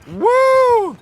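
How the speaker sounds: very high, loud cheer; excited whoop. Real, not acted. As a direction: whoop with joy. Celebrating a play.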